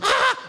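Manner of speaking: put-on high shriek of a little girl; girl's scream. Comedian imitating a frightened little girl, high shriek. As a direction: squeal like a startled kid. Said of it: "i stedet for at være sådan".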